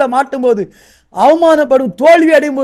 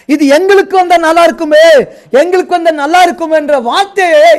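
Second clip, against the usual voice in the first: raised, loud preacher's exhortation; full-force preaching; 5 semitones higher, 5 dB louder than usual. Acted. Pastor urging the fathers, full-force preaching. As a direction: preach it out, building and pleading.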